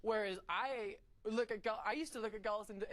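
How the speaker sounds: ordinary talk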